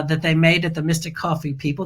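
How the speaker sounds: ordinary talk, nothing marked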